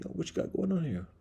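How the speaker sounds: high squeaky mouse voice asking around; curious mouse. Retold or imitated. Narrator voicing the mouse peeking at his screen, curious and high. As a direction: playfully voice a nosy mouse.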